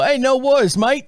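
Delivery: Australian accent impression; Aussie accent. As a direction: do a breezy Australian greeting. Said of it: "people from Australia come up and go".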